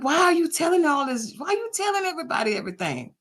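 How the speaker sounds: retelling a friend upset that she shares trade secrets, mildly indignant; retold upset friend. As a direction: mimic her mild indignant complaint. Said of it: "She was actually upset".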